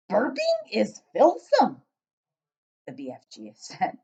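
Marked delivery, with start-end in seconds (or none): 0.1-1.8 booming, slow giant with odd grammar; loud giant voice. Acted. Giant questioning the girl, booming and puzzled. BFG disgusted.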